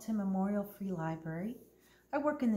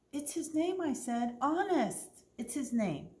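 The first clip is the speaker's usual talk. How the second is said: higher, earnest insistence; insisting; 8 semitones higher than usual. Acted. Opal insists to the staring manager, earnest. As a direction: convince him it's true.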